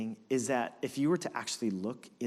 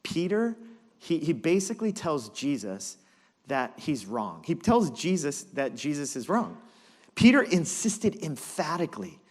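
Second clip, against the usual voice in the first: usual speaking voice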